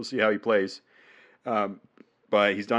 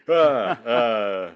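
normal talking voice

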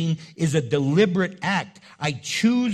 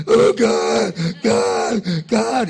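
loud, urgent cry to God; desperate plea; 7 dB louder, much slower than usual. Retold or imitated. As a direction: beg God in a panic. Mock desperate prayer in trouble.

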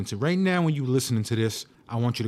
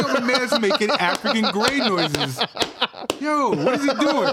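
laughing; 9 semitones higher than usual. Retold or imitated. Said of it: "I'm like".

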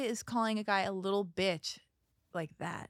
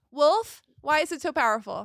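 calling out; 4 semitones higher, 10 dB louder than usual. Real, not acted. Calling out to producer.

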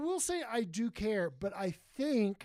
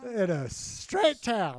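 slow, loud, over-the-top delivery; milk it for drama; dramatic; 5 dB louder, much slower than usual. Pretend. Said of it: "I love to be dramatic".

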